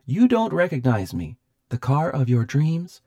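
ringing, high techno voice; chiming machine voice. A narrator's reading. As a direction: chime it out boldly as a sinister gadget. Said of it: "the voice chimed".

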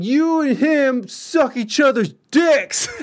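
high, loud, slow taunting shout; drunken shouted insult. Retold or imitated. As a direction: hurl it after him to provoke. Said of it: "I was like".

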